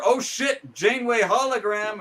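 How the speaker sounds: high, loud shocked outburst; hammed-up amazement; mock startled exclamation. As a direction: blurt out the big reveal. Acted startled reveal in recap.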